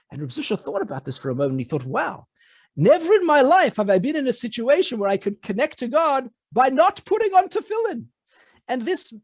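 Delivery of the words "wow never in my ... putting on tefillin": high, wondering wow of discovery; dawning delight at a new idea; amazed realization. Acted. Zusha realizing he can connect to God, high and amazed.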